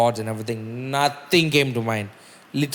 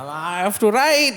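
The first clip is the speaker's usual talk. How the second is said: mock stressed whine; 7 semitones higher than usual. Stressed self quoted that night.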